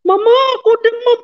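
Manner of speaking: high, rushed imitated yell; retold cry out. Sick daughter tells mother of fever, retold cry out. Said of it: "kata dengan teriak".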